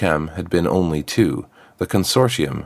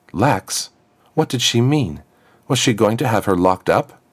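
higher, rising panicked questions; panicked thoughts; 5 semitones higher than usual. Acted. Girl panics about her stepmother's plan, voice rising in alarm. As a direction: race through the worries in alarm.

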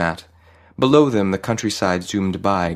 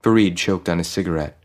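ordinary delivery, nothing marked

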